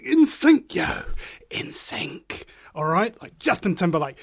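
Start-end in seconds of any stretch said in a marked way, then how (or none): 0.0-0.7 high, loud, playful call. Pretend. Playful echo after story. 1.5-2.5 unvoiced playful whisper. Whispered echo of same words.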